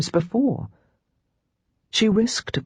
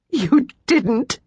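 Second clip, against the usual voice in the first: gasped; 7 semitones higher than usual. Acted. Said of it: "she gasped".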